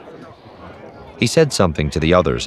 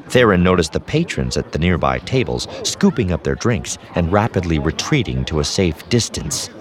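ordinary delivery, nothing marked

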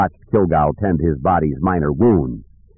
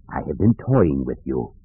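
low, quiet villain taunt; softly spoken, low-pitched menace; menacing dark lord; 6 semitones lower, 4 dB quieter than usual. Acted. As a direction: play a dark lord toying with his foe.

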